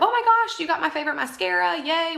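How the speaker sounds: high, bright squeal of delight over the mascara; excited voice message. Shown as a demonstration. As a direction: gush like a thrilled friend. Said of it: "let them hear the excitement of".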